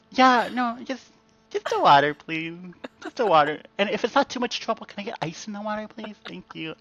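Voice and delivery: high, timid, overly sweet request; timid over-polite order. Retold or imitated. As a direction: shrink and ask as meekly as possible. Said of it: "I'll have to be like".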